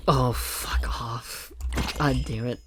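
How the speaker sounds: slow hushed "fuck off" under the breath; muttered whisper, spooked; whispered curse. Real, not acted. Startled, hushed curse in game.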